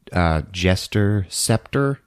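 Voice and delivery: ordinary talk, nothing marked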